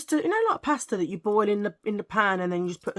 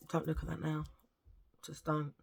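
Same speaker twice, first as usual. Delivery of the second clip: hushed aside; 3 semitones lower, 9 dB quieter than usual. Real, not acted. Aside telling listener not to look.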